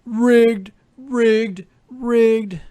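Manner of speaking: high, loud mocking yell; loud jeering chant of protest; mock fan scream. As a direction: shout it like a bitter fan crying foul. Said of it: "and scream".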